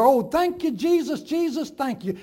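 loud, high cry of thanks; crying aloud. A narrator's reading. The farm wife cries her thanks to Jesus aloud. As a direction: burst out in grateful praise. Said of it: "she cried aloud".

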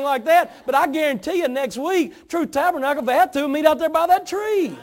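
loud, high-pitched impassioned pulpit delivery; full-force preaching. Real, not acted. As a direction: drive every phrase home to the whole congregation. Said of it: "I'm starting to preach here".

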